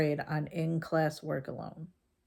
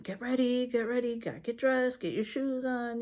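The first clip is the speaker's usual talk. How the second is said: high, rapid-fire morning orders; hurrying nag; 6 semitones higher than usual. Retold or imitated. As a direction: chivvy him out the door. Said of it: "getting on his ass".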